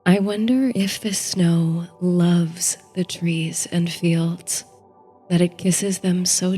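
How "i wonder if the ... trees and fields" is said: ordinary delivery, nothing marked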